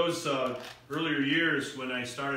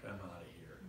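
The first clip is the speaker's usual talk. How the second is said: low, very soft grumble; understated annoyance; 8 semitones lower, 16 dB quieter than usual. Retold or imitated. Mocked student leaving the party, quietly ticked off. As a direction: say it under your breath, fed up. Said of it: "that just ticked me off".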